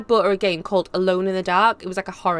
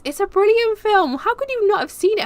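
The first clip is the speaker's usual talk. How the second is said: high-pitched mock indignation; playful disbelief; 13 semitones higher, 4 dB louder than usual. Streamer chiding a viewer over a film, playfully incredulous. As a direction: plead the film's case, pitched right up.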